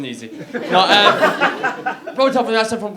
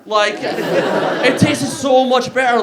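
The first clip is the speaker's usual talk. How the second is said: laughing shout; 6 semitones higher, 6 dB louder, much slower than usual. Real, not acted. Hummus punchline.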